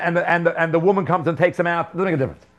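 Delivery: usual speaking voice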